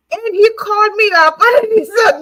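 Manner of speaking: loud, high-pitched, cracking up mid-story; real laughing. Real, not acted. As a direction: tell it through rising laughter. Laughing as she retells the scolding.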